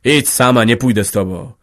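loud, harsh shout to go away; husband shouts his wife away from him; menacing shout. Acted. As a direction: drive her off with a threatening yell. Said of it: "krzyknął groźnie".

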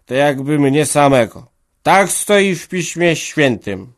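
put-on loud, high, slow drunken preaching; a tipsy organist lecturing the crowd; drunken sermonizing. A narrator's reading. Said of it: "Bełkotał niewyraźnie". (głośne, wysokie, pijane kazanie organisty)

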